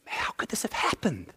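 hushed whisper of disbelief; whispered despair. Retold or imitated. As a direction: breathe it like a stunned mourner. Voicing the grieving believer.

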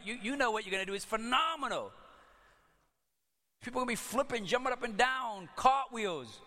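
high-pitched, exuberant build-up; hammed-up excitement. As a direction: hype it up, high and gleeful. Imagines the joy before the miracle.

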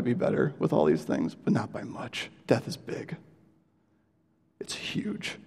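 sinking to a whisper; solemn, breathless hush. Retold or imitated. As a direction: let the preacher's verdict fade into a hushed whisper. Preacher's verdict voiced, dropping to whisper.

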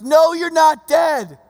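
drawn-out, high, loud cry; acted-out shout of refusal; retold yell. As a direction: yell it slowly, pitched well up. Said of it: "and yells".

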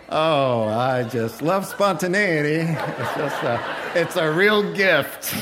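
laughing through a sarcastic aside; laughing sarcasm. Real, not acted. Preacher jokes to the congregation, laughing. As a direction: chuckle out the mock praise.

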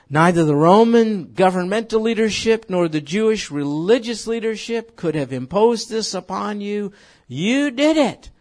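building loud and high; loud preaching. Real, not acted. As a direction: preach it out, rising. Preaching climax.

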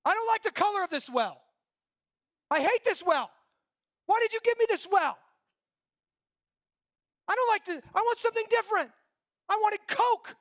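put-on high, loud, whiny childish tantrum; childish complaint. A thirsty man whining at the well, high childish tantrum. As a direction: whine like a spoiled child at the well. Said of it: "And you go".

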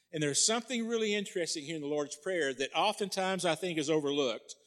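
ordinary delivery

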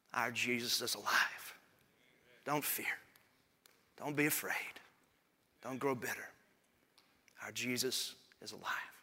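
soft, spaced preaching close; hushed reassurance. Real, not acted. Preacher reassures the congregation at sermon's end, softly.

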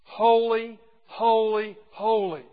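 each holy called out loud, falling away slightly; proclaimed threefold. Shown as a demonstration. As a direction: proclaim it like the creatures' song. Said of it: "song of the four living creatures".

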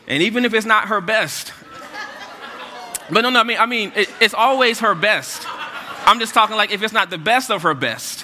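high, loud laughing correction; flustered backpedal. Real, not acted. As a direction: hurry to take it back, half laughing. Backpedaling loudly while laughing.

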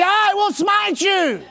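slow, drawn-out shout; bold declaration. Shown as a demonstration. Preacher shows the church how to answer cancer, slow shout. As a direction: shout it slowly at the sickness like a warrior. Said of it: "You need to say to that bankruptcy".

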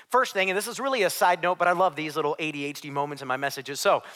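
ordinary delivery

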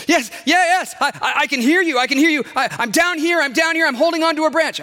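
high, loud, stammering excitement; frantic shouted reply. A narrator's reading. Man on a branch answers a voice above, high frantic shout. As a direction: shout up desperately to be found.

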